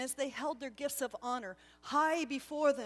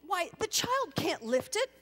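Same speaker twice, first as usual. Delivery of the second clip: higher, louder anxious objection; protesting; 8 semitones higher, 6 dB louder than usual. Acted. Gasper protesting to the angel, flustered. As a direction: push back, flustered. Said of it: "But still he protested".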